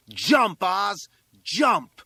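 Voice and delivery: put-on high, loud boy's shout; egging him on. A narrator's reading. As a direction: urge a friend on at a yell. Classmate urges boy to jump.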